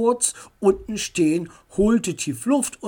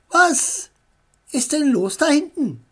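raised voice from the front seat; calling back; 9 semitones higher, 5 dB louder than usual. A narrator's reading. Mutter ruft nach hinten im Auto. (ruft laut nach hinten)

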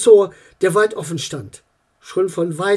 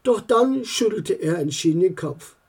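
plain reading voice

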